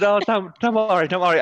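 lightly hammed-up French accent; put-on French accent. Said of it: "I've just turned into a Frenchman there".

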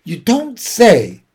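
slow, high, drawn-out reaction; suspicious surprise. A narrator's reading. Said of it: "eyeing the sac suspiciously".

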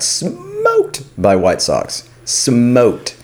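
"smoked" stretched out long, then repeated; drawn-out emphasis. Real, not acted. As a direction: lean hard and slowly on "smoked". Emphatic recall of a crushing loss.

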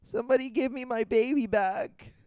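high, loud mock plea; mock frantic mother. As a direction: wail it like a panicked mom.

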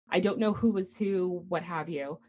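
normal talking voice

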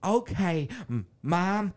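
high, halting, drawn-out answer; mock dopey son's holler; slow-witted son. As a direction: stammer back to mom, stretching the last word. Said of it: "the one guy's like".

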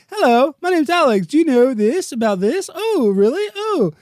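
high squeaky host voice; mock chatty streamer. Parodying himself chatting on stream, high and squeaky. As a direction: chirp it like a ditzy presenter. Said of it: "I'm gonna be talking and be like".

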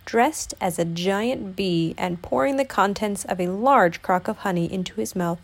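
usual speaking voice

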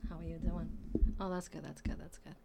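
normal talking voice

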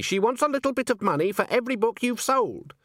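high, insistent witch voice; Granny. A narrator's reading. Witch demands payment on her friend's behalf, high and insistent. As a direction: press the demand as an imperious witch.

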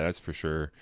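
ordinary delivery

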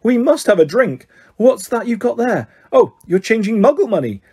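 high-pitched eager enthusiasm; lifted voice, building to an excited call; delighted, excited. A narrator's reading. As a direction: bubble over with excited curiosity. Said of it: "He pointed excitedly".

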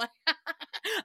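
high burst of laughter; real high-pitched cackle at your own joke. Real, not acted. Host cracking up at their own allegation, high laugh.

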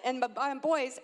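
normal talking voice

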